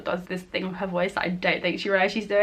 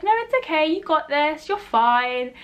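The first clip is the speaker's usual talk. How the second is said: reassuring mentor; 8 semitones higher than usual. Retold or imitated. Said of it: "she's like".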